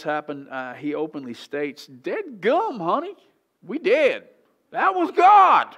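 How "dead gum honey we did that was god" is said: hillbilly Manoah. Retold or imitated. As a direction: ham up a country bumpkin blurting it out. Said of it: "I don't think he quite said it like that".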